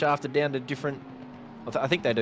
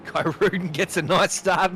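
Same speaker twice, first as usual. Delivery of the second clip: laughing; 6 semitones higher than usual. Real, not acted. Pile-up in pit lane.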